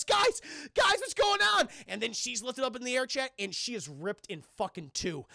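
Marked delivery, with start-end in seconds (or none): 0.0-1.7 high, loud mock-frightened shout; panicked calling. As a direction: call out high in hammed-up panic. Tanya calls out in panic.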